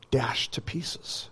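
slow, hushed whisper; drawn-out whispered warning; grave whisper. Real, not acted. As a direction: let it fall to a whisper. Grave prophecy dropped to a whisper.

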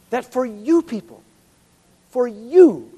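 building "for you" with force; emphatic for you. Shown as a demonstration. Preacher driving promise home to congregation, building emphasis. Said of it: "Peter ... is emphasizing".

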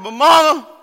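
put-on high, loud plea of a little boy; crying child. Little boy to his crying mother, loud plea. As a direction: cry out to mama as a small boy. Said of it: "went to his mama crying".

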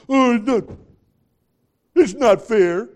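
put-on high, loud childish whine; mock a sulking kid; whining child. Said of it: "fair to your children according to them".

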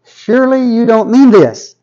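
very high, incredulous objection; Habakkuk protesting. Acted. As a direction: squeal a disbelieving protest up to God. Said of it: "Habakkuk responds".

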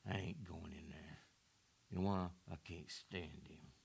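low, soft, sulky refusal; sullen mutter. Acted. Older son refusing to go in, low and sullen. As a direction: grumble it under your breath. Elder brother sulking.

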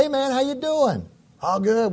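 put-on high, breezy young coworker; coworker's voice. Young coworker greeting the pastor, high and breezy. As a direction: toss it off high like a casual young guy.

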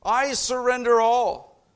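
loud, high, ringing sermon climax; fervent loud preaching; preaching at full force. Acted. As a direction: preach it out to the back row.

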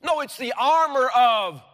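preaching at full force, rising to a shout; fiery preaching. Acted. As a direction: rouse the congregation.

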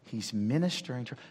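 ordinary talk

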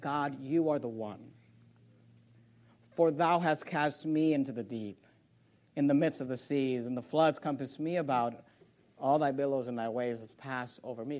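low, soft, measured recitation; solemn scripture prayer. Real, not acted. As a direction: bring it down to a hushed confession before God.

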